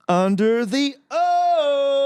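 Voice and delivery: high, slow bingo call; drawn-out high call. Retold or imitated. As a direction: stretch it out high like a caller. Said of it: "Did you do the".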